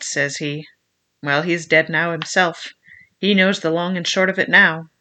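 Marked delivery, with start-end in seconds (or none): none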